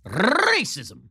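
RACISM with a very long rolled R, high-pitched; mock dramatic racism. Host mocking the media's attack line, drawn-out trilled RACISM. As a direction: roll the R of "racism" endlessly, mock-alarmed.

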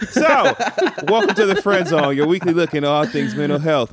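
high, laughing welcome. Real, not acted. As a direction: laugh through the show intro.

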